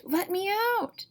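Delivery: high, strained cry from the sack; a trapped thing screaming to get free; muffled voice shrieking from inside a sack; harsh cry. Acted. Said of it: "a harsh voice cried".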